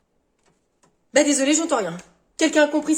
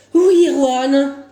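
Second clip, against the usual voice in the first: weary teacher; 7 dB louder, much slower than usual. Acted. Teacher calling on pupil.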